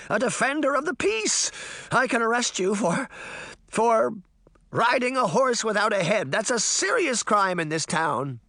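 high, blustering protest; frightened cry. A narrator's reading. Deputy threatening the Horseman with arrest, crying out. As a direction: bluster in a high panicky voice, faltering mid-threat. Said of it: "he cried".

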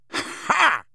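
single exultant "ha"; triumphant "ha!". A narrator's reading. Ron hearing that Harry kissed Cho, triumphant. Ron's triumphant laugh at news.

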